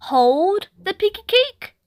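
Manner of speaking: put-on high little girl echo; toddler echo. A narrator's reading. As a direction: copy Mum in a bright small-child voice. Ruby asking.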